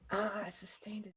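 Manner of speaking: unvoiced aside to self; whisper. Real, not acted. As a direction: whisper it to yourself. Whispers the move to herself.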